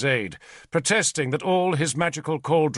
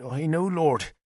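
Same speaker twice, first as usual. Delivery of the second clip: ordinary delivery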